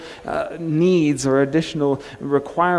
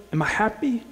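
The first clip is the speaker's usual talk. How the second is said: Scottish villain; 8 semitones higher, 3 dB quieter than usual. Retold or imitated. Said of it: "He's got this Scottish accent".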